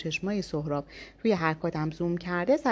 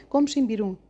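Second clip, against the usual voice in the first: louder, higher angry order; shout; 4 semitones higher, 5 dB louder than usual. A narrator's reading. Yas ordering the men out, loud angry shout. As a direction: throw them out with a yell. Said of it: "بلند داد زد".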